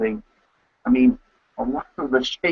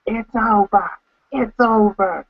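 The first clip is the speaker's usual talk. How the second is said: Joey Styles call; 8 semitones higher, slower than usual. Retold or imitated. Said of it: "as Joey Styles would, uh, would say".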